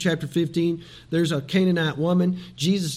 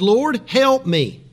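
slow, raised plea, acted out; pleading; 5 semitones higher, 5 dB louder, much slower than usual. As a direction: draw out a louder, higher plea as if begging. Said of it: "She keeps pleading to him".

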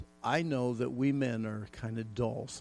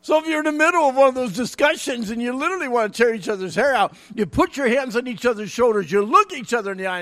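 excited fast preaching; 9 semitones higher, 12 dB louder, faster than usual. Real, not acted.

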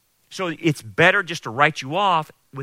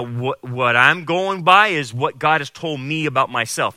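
ordinary talk

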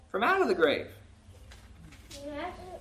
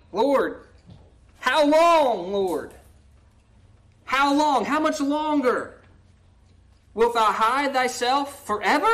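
louder, higher pleading cry to God; pleading psalm read; 3 semitones higher, 4 dB louder, slower than usual. Acted. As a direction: beg the Lord, voice rising. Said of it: "he's pleading, he's begging".

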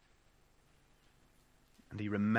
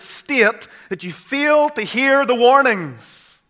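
loud, high, urgent sermon warning; full-force preaching; 8 semitones higher, 12 dB louder than usual. Real, not acted. Preacher warning of a hard-hearted state, impassioned and loud. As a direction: drive it home, slowing and loudest at the end.

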